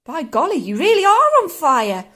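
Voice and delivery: high, loud amazement; astonished yell. A narrator's reading. George seeing smoke from Grandma, astonished yell. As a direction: rise to a yell of discovery. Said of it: "George yelled".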